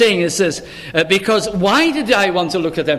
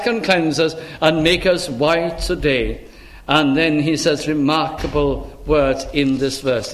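usual speaking voice

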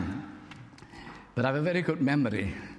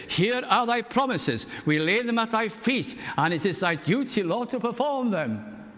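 bold prayer; 10 semitones higher than usual. Retold or imitated. Old revival folk praying, daring and high-pitched. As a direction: plead boldly up to heaven. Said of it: "But they would say".

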